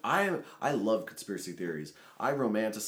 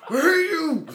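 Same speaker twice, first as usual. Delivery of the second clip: high, loud mock screech; retold hateful scream; 11 dB louder, slower than usual. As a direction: act out a comic burst of rage. Retelling outburst while chopping pepper.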